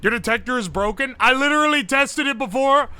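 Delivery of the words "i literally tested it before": louder, higher, defensive plea to a judge; mock courtroom plea. Streamer defending the broken detector, mock pleading louder and higher. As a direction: protest like a defendant begging Your Honor.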